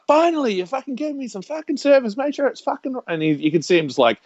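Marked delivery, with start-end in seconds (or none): 0.1-3.0 high-pitched, hammed-up indignation; mock irate customer. Irate customer to the clerk who gave in, mock outrage. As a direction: imitate her griping way up high. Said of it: "And she's like".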